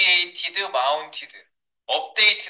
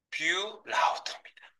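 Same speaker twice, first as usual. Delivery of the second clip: whisper; 10 dB quieter, slower than usual. Acted. Dramatic reveal.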